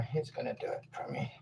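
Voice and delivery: soft unvoiced aside; whisper. Real, not acted. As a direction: whisper it to yourself. Aside while son in tub.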